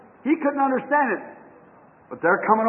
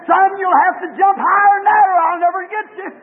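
higher, louder call; a mother eagle urging her son to jump higher; 6 semitones higher, 4 dB louder than usual. Acted. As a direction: shout it up to him. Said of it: "She said".

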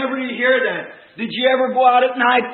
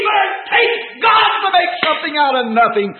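raised, high pulpit cry; preaching shout; 7 semitones higher, 4 dB louder than usual. Real, not acted. Preacher putting God above titles and degrees, fervent shout. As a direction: declare it fervently over the congregation.

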